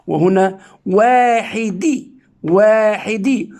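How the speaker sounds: واحدي stretched slowly, ending in in; slow reading with nunation. Shown as a demonstration. Teacher sounding out the tanween in واحدي. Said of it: "أنطق الكسرة الزائدة نونا ساكنة".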